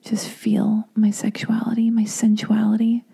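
breathy whispered inner thoughts; hushed, dreamy secret musing; sultry whisper. Retold or imitated. To herself in a shared Lyft, whispering. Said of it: "and I was like".

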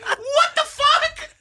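very high, drawn-out shriek; mock alarm. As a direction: screech it in hammed-up shock. Acting startled at the hold-up.

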